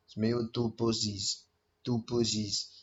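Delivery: low, quiet, deadpan delivery of the punchline; deadpan joke voice. Retold or imitated. Fighter deadpans his photo joke to friends, quiet and dry. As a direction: say it dry and soft, like a straight-faced joke.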